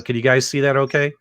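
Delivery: usual speaking voice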